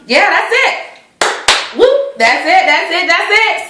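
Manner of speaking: high, bouncy that's it; cheerful sing-song outro; playful sing-song. Real, not acted. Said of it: "playful sign-off".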